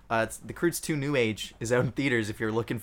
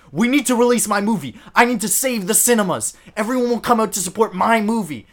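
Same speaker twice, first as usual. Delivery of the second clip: loud, higher pompous director plea; mock Christopher Nolan; 8 semitones higher, 9 dB louder than usual. Host mocks Christopher Nolan pleading for cinemas, loud and higher. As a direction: ham up a self-important filmmaker begging. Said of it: "Christopher Nolan is like".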